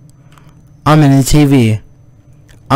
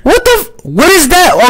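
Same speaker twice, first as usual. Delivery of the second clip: very high shrieking blurt; startled shriek. Real, not acted. Player sees something, very high shriek. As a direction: shriek it before you can stop. Scare in game.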